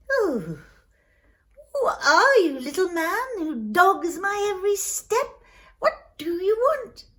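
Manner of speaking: high, swooping frost-spirit voice; haughty Mister Frost. Acted. Mister Frost to the little man following him, haughty. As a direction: challenge the intruder with big pitch swoops.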